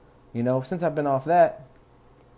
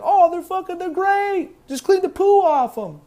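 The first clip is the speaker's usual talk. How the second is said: very high squeaky voice, a bit louder and eager; Jim the berry eater; 6 dB louder than usual. Retold or imitated. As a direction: chirp it way up high, cheerfully insisting. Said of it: "He's like".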